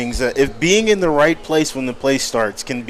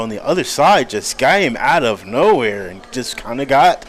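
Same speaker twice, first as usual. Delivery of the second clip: ordinary delivery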